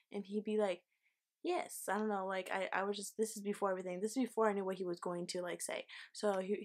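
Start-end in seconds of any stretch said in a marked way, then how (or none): 1.4-1.9 a high, clipped "Yes" in a mock British accent; British lad. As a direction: lightly put on a British lad's reply. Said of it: "how British lads talk".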